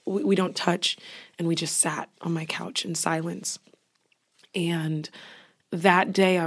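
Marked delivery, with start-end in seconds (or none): 1.4-5.1 soft hushed voice; whispering. Real, not acted. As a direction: whisper the memory. Hushed emotional memory.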